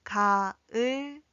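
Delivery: separated 가을거지 for learners to follow; syllable by syllable. Shown as a demonstration. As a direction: model 가, 을, 거, 지 one at a time. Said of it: "함께 연습해 볼까요".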